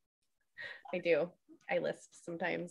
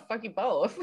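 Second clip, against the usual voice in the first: slow, laughing fuck you both; 3 semitones higher, 7 dB louder, slower than usual. Real, not acted. Teasing both friends back, laughing slowly. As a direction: laugh through the words.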